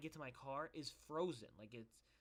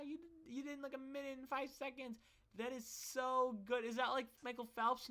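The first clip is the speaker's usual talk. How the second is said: adoring mother, pitched way up and bubbly; gushing mom; 11 semitones higher than usual. Retold or imitated. Mom praising son's fifty meter time, high and gushing.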